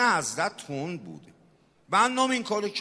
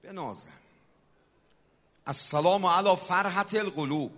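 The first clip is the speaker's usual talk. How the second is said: usual speaking voice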